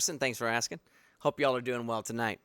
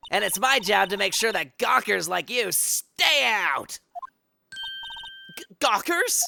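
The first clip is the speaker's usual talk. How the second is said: put-on grumpy old security lady; 6 semitones higher than usual. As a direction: nag the tourists like a crabby old guard.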